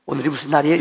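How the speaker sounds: usual speaking voice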